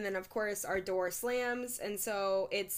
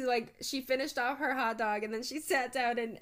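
usual speaking voice

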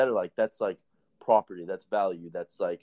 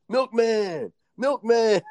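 much higher, sing-song teasing call; mocking nickname; 14 semitones higher, 5 dB louder, much slower than usual. Inmates tease him as he walks by, sing-song mock call. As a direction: taunt him with his new nickname.

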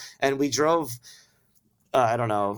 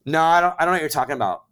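hurried denial; 3 semitones higher, 5 dB louder, much faster than usual. Retold or imitated. Denying to cops, rushed.

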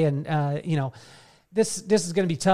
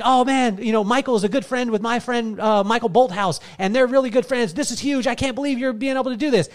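higher, excited retold gush; friend's excitement; 6 semitones higher than usual. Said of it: "he was like".

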